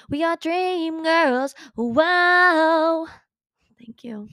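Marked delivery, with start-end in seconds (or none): 0.1-3.1 high, drawn-out dreamy singsong. Pretend. Host hyping the girls as dream girls, dreamy. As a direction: float up high and stretch it out, swelling louder. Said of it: "That sounded dreamy to me".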